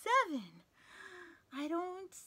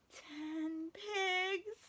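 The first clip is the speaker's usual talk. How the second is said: ordinary delivery, nothing marked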